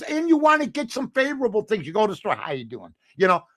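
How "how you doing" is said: low, soft Southern greeting; picked-up Southern accent. Retold or imitated. Newcomer greeting folks at the store, soft Southern accent. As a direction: toss off a slight imitated Southern accent. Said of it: "starting to pick up some of the nuances".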